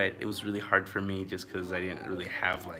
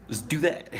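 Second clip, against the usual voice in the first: eager; 8 semitones higher, 6 dB louder than usual. Retold or imitated. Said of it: "And I was like".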